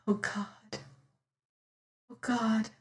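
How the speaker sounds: soft, broken whisper. Acted. Damaris whispers to God in despair, very soft. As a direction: breathe it out in despair. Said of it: "she whispered".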